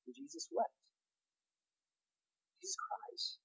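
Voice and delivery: barely breathed, unvoiced and soft; hushed whisper. Real, not acted. Pastor to his congregation, hushed awed whisper. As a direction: let the words fall almost silent in awe. Pastor pauses on the shortest verse.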